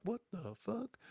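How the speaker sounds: high, hushed mock bewilderment; quietly acted confusion; baffled reaction. Acting the baffled newcomer's reaction.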